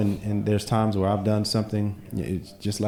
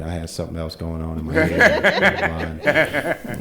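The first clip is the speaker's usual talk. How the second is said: laughing excuse; 3 semitones lower, 4 dB louder, slower than usual. Retold or imitated. Sheepish excuse to wife, laughing.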